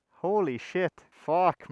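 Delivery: normal talking voice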